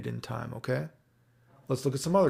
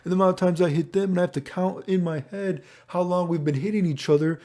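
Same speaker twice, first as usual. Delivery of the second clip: higher-pitched mock overwhelmed player; hammed-up complaint in a raised voice; imagined complaining player; 5 semitones higher than usual. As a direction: act out a player griping about complexity. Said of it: "someone's gonna be like".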